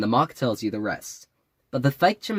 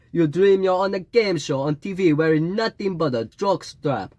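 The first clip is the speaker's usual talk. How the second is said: put-on high, drawn-out Jamaican accent; fake Jamaican mystic; 6 semitones higher than usual. A narrator's reading. Fake Jamaican spouting dream wisdom at the narrator, in a lilting mystic voice. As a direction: play a fake Jamaican psychic holding forth.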